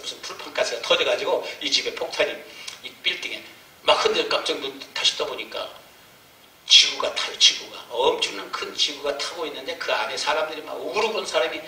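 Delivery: usual speaking voice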